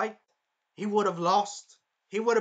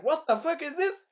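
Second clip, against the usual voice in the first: higher, baffled cry; shocked outburst; 8 semitones higher than usual. Retold or imitated. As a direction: caught off guard by the blow. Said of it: "And he's like".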